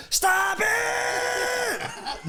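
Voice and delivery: high, drawn-out shrieking yell; imitating an enraged boy's yell; furious kid shout. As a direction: explode like a fed-up little boy. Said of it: "Not exaggerating the reaction".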